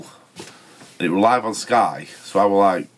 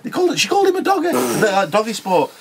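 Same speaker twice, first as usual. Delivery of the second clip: indignant. Retold or imitated. Indignant retelling of the slur.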